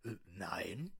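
low put-on voice; disguised deep voice. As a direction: drop the voice low. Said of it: "meine Stimme zu verstellen".

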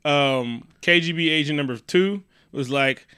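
ordinary delivery

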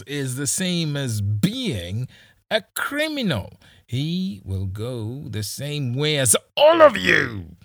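high, loud, sneering mockery; mock the plea, then condemn him; sneering police boss. Kisii sneering at Roba's plea, high and loud.

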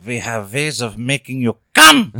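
put-on slow, menacing German villain accent; cool foreign spy menace in a German accent. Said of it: "He goes German".

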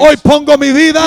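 high, loud pulpit prayer; forceful prayer. Acted. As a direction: pray it out with full force. Prays at full force.

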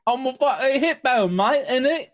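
hammy British cockney hippo lady; a cartoon British accent, high and loud; mock British accent. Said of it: "I wrote that".

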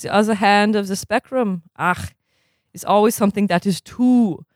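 lightly put-on German accent, formal and measured; a stern German coach scolding in a mild German accent. Stitt lecturing sluggish players at dawn drills, mild German accent. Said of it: "do the accent again".

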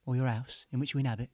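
cheesy ad read, loud and upbeat; advert pitch. Acted. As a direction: sell it like a late-night advert. Spiritual healers' advert pitch.

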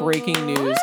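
ordinary talk

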